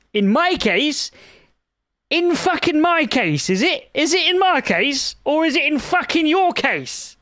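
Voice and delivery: sarcastic furious interrogation; indignant rant. Real, not acted. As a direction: throw the blame back, incredulous. Ranting at ChatGPT blame.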